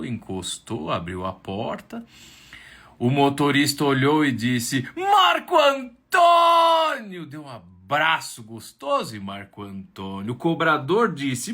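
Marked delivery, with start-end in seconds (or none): 5.0-7.3 very high, loud, drawn-out shout; elated shout. Acted. Bus driver opening the door, shouting his name high and slow. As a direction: greet him like a celebrity.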